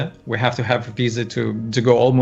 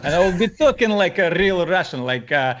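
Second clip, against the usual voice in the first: higher-pitched, over-the-top movie Russian accent; Hollywood Russian accent; 4 semitones higher than usual. Shown as a demonstration. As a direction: lay on a thick movie-style Russian accent. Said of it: "Hollywood-inspired Russian accent".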